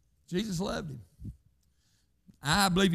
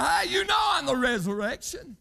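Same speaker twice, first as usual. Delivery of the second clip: high, loud proclamation; preacher voicing Jesus with a big, high shout; 10 semitones higher, 3 dB louder than usual. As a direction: cry out as Jesus declaring who he is. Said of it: "He said".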